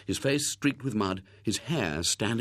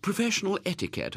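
aunt voice; 11 semitones higher, faster than usual. A narrator's reading. Aunt speaks knowingly.

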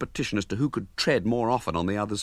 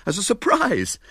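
ordinary delivery, nothing marked

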